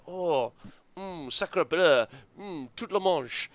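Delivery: mock French chef voice; hammed-up French accent, slow. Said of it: "I just was like".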